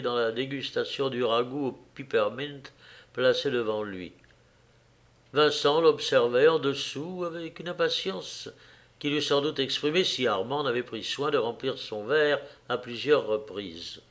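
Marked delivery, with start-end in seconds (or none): none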